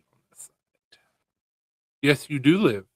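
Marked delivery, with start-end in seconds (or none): 0.1-1.0 whispered aside; breathy whisper under breath. Real, not acted.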